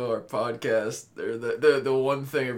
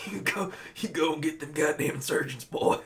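a hint of gruff tough guy; lightly growled tough-guy encouragement; child doing a tough-guy voice; tough-guy Bernthal; 3 semitones higher, 3 dB quieter than usual. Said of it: "I talk like fucking John Bernthal".

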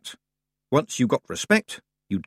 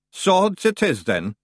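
higher, grudging Scottish chief; reluctant Feegle; 9 semitones higher, 3 dB louder, slower than usual. A narrator's reading. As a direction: give in against your will. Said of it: "said Rob Anybody reluctantly".

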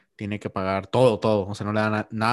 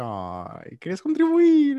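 delighted; 4 dB louder, much slower than usual. Retold or imitated. Acted delighted reply to date.

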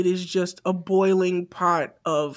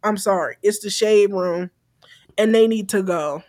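ordinary talk, nothing marked

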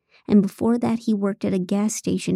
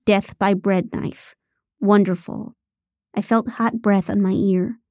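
ordinary delivery, nothing marked

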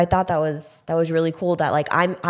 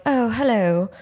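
imagined goose voice; 4 semitones higher than usual. Acted. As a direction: give a backyard goose its own accented voice. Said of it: "What accent do you imagine?".

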